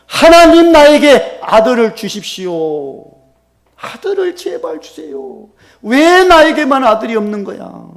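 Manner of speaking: loud, high, anguished plea to God; wailing prayer. Acted. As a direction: cry out desperately, dragging the words. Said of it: "통곡했어, 통곡. 뭐 달라고요?".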